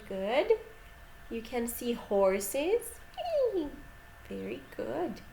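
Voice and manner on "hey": very high imitated neigh; high horse noise for kids; horse whinny.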